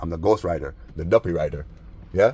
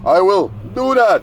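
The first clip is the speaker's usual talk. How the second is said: much higher, louder squeal; mock high yell; 12 semitones higher, 8 dB louder, slower than usual. Mocking the neighbor's threat to call police, high loud squeal. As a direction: squeal it out in mockery.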